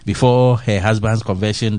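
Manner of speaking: usual speaking voice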